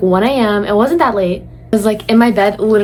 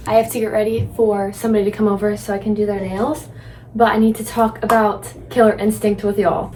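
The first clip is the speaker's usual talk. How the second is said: usual speaking voice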